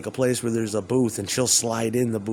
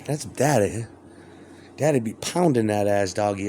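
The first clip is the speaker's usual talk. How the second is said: ordinary talk, nothing marked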